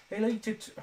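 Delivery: high, put-on voice attempt; high-pitched voice. Shown as a demonstration. Streamer testing a high impression voice for chat, high-pitched. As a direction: try out a high voice, breaking off. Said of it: "so this high-pitched voice".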